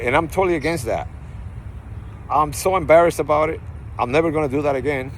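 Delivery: ordinary delivery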